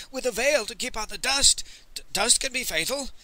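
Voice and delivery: high, worried merchant voice; anxious interjection. A narrator's reading. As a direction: break in nervously, stumbling on DUST.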